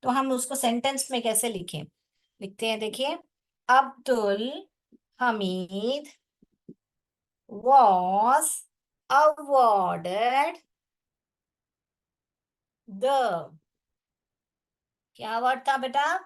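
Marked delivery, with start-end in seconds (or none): 3.7-13.5 word by word, long pauses between words; spaced-out classroom dictation; dictating. Real, not acted. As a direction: dictate slowly as you write it out. Teacher dictating answer while writing.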